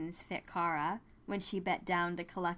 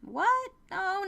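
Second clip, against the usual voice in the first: surprised; 13 semitones higher, 6 dB louder than usual. A narrator's reading. Wynn surprised at being offered money.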